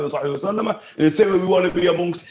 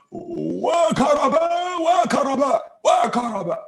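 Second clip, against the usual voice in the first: high, slow lament; mournful calling out; 8 semitones higher, slower than usual. Retold or imitated. Bilal's wife grieving at his deathbed, slow lament. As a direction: wail it out slowly. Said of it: "she's calling out how difficult".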